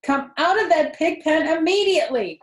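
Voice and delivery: loud high scolding; cried out. A narrator's reading. Mother crying out at Avery in the pen. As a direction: order him out at once. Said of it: "cried Missus Arable".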